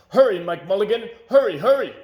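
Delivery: loud, high crowd shout urging him on; shouted cheer. A narrator's reading. Crowd urging Mike Mulligan to hurry, shouting. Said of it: "shouted everyone".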